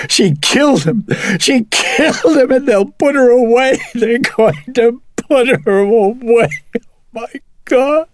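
wailing at a very high, loud pitch; hysterical sobbing shriek. A narrator's reading. Mother terrified for her daughter, hysterically sobbing. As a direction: shriek it out, breaking into sobs.

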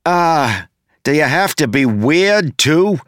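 high, complaining question; exasperated whine. A narrator's reading. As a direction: whine at a creepy rival. Exasperated reply to creepy foe.

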